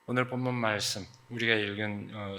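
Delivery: ordinary talk, nothing marked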